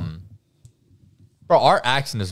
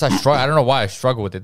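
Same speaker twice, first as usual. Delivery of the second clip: ordinary talk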